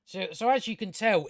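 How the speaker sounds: ordinary talk, nothing marked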